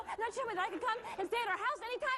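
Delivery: ordinary talk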